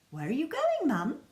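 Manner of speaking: put-on high, loud child's question; child asking. Laura asking her mum where she's going, high and loud. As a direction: call after mum, curious. Laura calls after mother.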